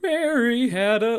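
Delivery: high, slow cracking voice with a croak; broken joke voice. Shown as a demonstration. [name] demos his [name] joke voice for the class, slow and cracking. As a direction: croak it out like a voice breaking apart. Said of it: "in a very, like, broken voice".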